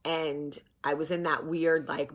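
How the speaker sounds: ordinary talk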